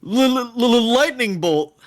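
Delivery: hammed-up stuttering battle shout; stuttered shout. A narrator's reading. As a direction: stammer on the first L, then call out the spell. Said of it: "as Spades shouts".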